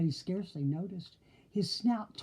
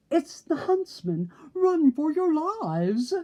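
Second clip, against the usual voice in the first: high, loud terrified yell; panicked yell; 10 semitones higher, 6 dB louder, slower than usual. A narrator's reading. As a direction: scream a warning and flee. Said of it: "yelled the wolves".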